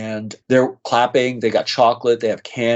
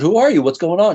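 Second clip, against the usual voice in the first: retold startled question, pitched higher and louder; 7 semitones higher, 4 dB louder than usual. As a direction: blurt it out, caught off guard. Stranger suddenly hugging and weeping.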